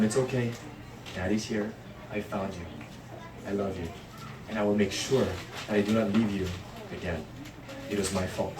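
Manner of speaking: very soft, hushed comfort; tender reassurance. Pretend. Father planning to comfort lost son, soft and tender. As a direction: murmur it gently like holding a scared child.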